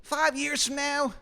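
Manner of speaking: very high, thin falsetto voice; Shithead Steve's falsetto. Acted. GM voicing the dead Steve with a parting reassurance, high falsetto. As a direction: pipe it up high and silly. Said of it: "He goes".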